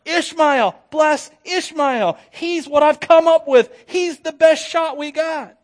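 high, loud, insistent plea; worked-up shouting, stretching the key words; loud pleading. Acted. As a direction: argue desperately with God. Acting Abraham pleading with God.

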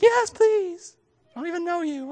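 high, loud mock polite reply; sarcastic mock politeness. Pastor mocking a grocery stranger who wants to touch the belly. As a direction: fake sweetness toward a stranger. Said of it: "And like".

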